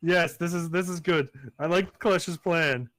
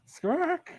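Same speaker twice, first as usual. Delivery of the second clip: high rising squawk; bird squawk. Acted. GM voicing the deathhawk flying away, high squawk. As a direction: screech as it takes off.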